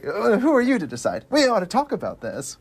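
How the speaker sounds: high-pitched indignant challenge; evil passenger. Acted. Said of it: "just that guy, again, back on the ferry".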